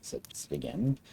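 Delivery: plain reading voice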